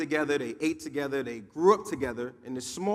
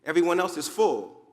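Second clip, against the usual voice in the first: ordinary delivery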